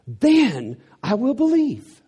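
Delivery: high, slow and loud, demanding; a doubter's sarcastic demand, loud and slow; mock skeptic ultimatum. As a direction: lay down terms like a doubter.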